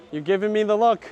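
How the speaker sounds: high, loud playful accusation; teasing call-out. Real, not acted. Husband catching wife's look while shopping, teasing call-out.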